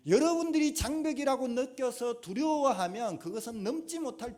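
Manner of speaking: loud, high fervent preaching; a sermon rising to a shout; preaching at full force. Acted. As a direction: build it up like a revival preacher. Preaching climax.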